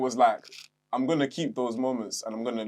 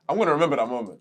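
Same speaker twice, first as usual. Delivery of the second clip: ordinary talk, nothing marked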